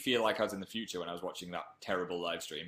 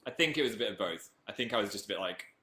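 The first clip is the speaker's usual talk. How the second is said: ordinary delivery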